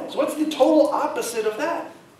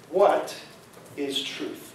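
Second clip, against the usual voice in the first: low, quiet, with a pause after "What"; hushed, halting question; 9 semitones lower, 4 dB quieter, slower than usual. Acted. Preacher quoting Pilate at the trial, low halting question. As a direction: let "What ... is truth?" sink down softly, broken by a pause.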